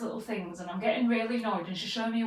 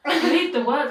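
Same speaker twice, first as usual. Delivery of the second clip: louder insistence; 4 semitones higher, 10 dB louder, slower than usual. Retold or imitated. Host woman repeats, louder.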